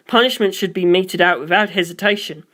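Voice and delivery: ordinary delivery, nothing marked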